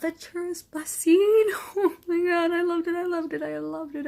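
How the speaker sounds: high, loud, laughing through the words; overjoyed fan gushing; giddy delight. Real, not acted. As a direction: squeal it like a thrilled fan. Thrilled recalling favorite scene.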